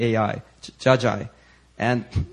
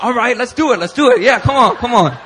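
higher and louder, cheering the effort on; fired up; 9 semitones higher, 8 dB louder than usual. Retold or imitated. Speaker joining the pastor's deliverance, eager and louder. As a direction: pump yourself up, half laughing.

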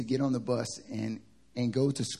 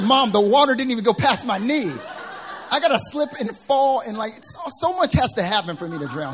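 exasperated son; 11 semitones higher, 9 dB louder than usual. Retold or imitated. Son arguing with mom.

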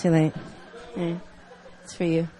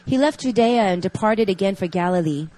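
reading scripture; 4 dB louder than usual. Acted. Reads scripture passage aloud.

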